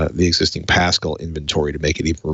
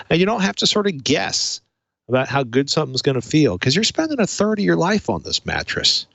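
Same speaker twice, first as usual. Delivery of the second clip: upbeat host-read mattress ad, climbing higher mid-pitch; 5 semitones higher than usual. Acted. Host reads the Casper sponsor spot to listeners, upbeat ad read. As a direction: sell it like a chatty podcast sponsor spot.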